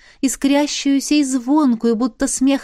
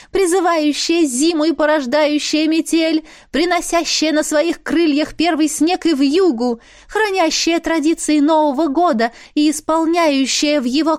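higher solemn ringing sprite voice; ringing invocation; 6 semitones higher than usual. A narrator's reading. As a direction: proclaim the ritual oath. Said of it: "звонкий голос стихийницы". (звонкое торжественное воззвание)

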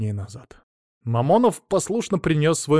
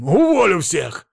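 loud, high, drawn-out threat; yelling; 6 semitones higher, 6 dB louder, slower than usual. A narrator's reading. Millionaire threatening his workers, yelling. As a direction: roar the threat slowly. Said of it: "заорал он".

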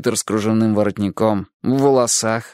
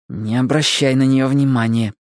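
usual speaking voice

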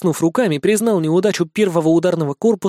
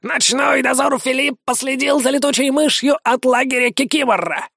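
higher, chattering parrot; 7 semitones higher than usual. A narrator's reading. Parrot reports to Nikitka, high and quick. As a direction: report it like a bird.

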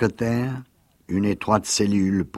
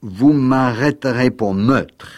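booming; 5 semitones higher, 7 dB louder than usual. Acted. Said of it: "d'une voix qui résonna comme un gong d'airain".

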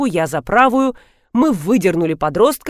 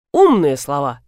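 admiring; 6 semitones lower, 3 dB louder than usual. A narrator's reading. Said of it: "восхитился Антонов".

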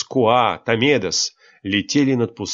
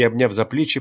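ordinary delivery, nothing marked

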